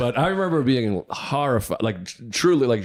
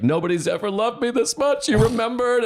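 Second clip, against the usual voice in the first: much higher, squeaky mock-tearful gush; mock bawling girlfriend; 13 semitones higher than usual. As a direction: imitate a girlfriend blubbering over a gift, pitched way up. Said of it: "just started bawling".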